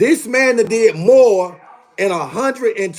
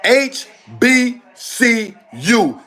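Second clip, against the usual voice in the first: slow shouted acronym; spelled out slowly; 2 semitones higher, much slower than usual. Real, not acted. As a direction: call out H B C U letter by letter. Spelling out letters.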